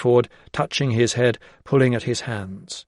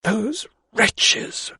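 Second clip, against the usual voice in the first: hissed; 11 semitones higher than usual. Acted. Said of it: "she hissed through clenched teeth".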